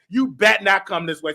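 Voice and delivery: ordinary talk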